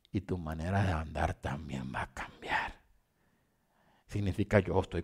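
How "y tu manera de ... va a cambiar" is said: soft, low dramatic whisper. Acted. Preacher hushes the congregation for God's promise, whispering. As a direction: drop to a hushed whisper for effect.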